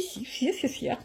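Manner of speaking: quiet giggling, half mocking; laughing softly. Real, not acted. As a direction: laugh while you insist on it. Laughing at her own pride.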